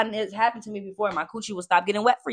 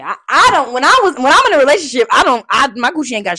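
loud; 6 semitones higher, 12 dB louder than usual. Real, not acted. Loud animated.

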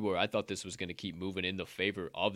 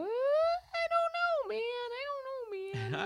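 mock falsetto; much slower than usual. Mock sing-song over chart.

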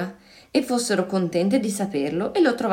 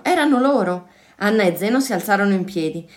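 plain reading voice

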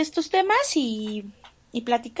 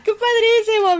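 excited; 10 semitones higher, 6 dB louder than usual. Real, not acted. Gushing over happy news.

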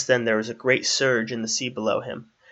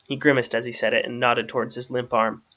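usual speaking voice

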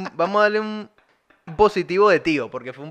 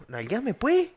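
higher put-on voice, playful; mock sultry request; 8 semitones higher, much slower than usual. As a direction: lift the voice up, teasing. Reggaeton voice, high pitched.

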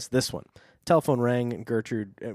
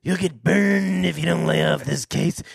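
slow, whispered menace; hammed-up creepy caller; sinister caller; 3 semitones higher, 4 dB louder than usual. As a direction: whisper the threat slowly. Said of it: "he said".

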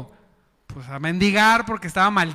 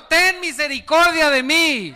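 louder, higher cry for mercy; pleading shout; 6 semitones higher, 3 dB louder than usual. Shown as a demonstration. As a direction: beg out loud for mercy. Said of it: "Dígalo".

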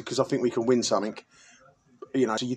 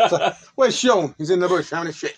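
amused; 5 semitones higher, 4 dB louder than usual. Real, not acted. Joking about absent teammate.

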